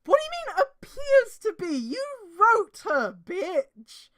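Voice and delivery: high, loud, slow indignant rant; mock outrage. Reader berating the fic's writer, mock outrage.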